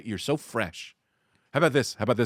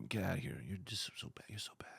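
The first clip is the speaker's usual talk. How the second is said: sexy low hush fading to a whisper; hushed bouncer; 4 semitones lower, 18 dB quieter, faster than usual. Pretend. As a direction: breathe it at the heckler like a seduction. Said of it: "a heckler, and go".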